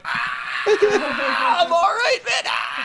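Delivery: high-pitched wailing laugh-shout; crying laughing. Retold or imitated. Re-enacting being high and reassuring a worried friend, crying laughing. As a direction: squeal it through hysterical, tearful laughter. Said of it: "I was fucking crying laughing".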